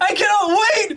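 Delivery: high-pitched hyped scream; excited shouting. Real, not acted. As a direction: shriek with real anticipation. Fan hyped for the fight.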